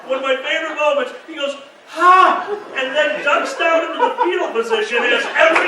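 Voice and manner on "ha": loud, high single burst; hammed-up gloating cry; triumphant ha. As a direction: bark out a mock triumph. Acts out friend's triumphant cry.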